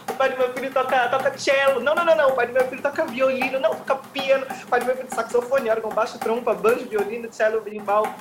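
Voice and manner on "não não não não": loud high no; frantic denial. Acted. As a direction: shout the nos, higher. Rejecting each guess, agitated.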